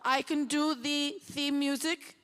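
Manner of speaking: a self-admittedly weak Danish accent impression. Quoting the Danish composer's praise to the festival crowd, in a mild Danish accent. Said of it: "in his Danish accent".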